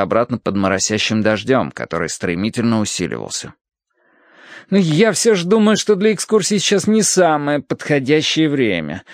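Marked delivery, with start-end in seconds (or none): 4.7-9.0 reluctant objection in a higher voice; balking. A narrator's reading. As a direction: dig in politely against the visit. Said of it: "упирался священник". (упираясь, высоким голосом)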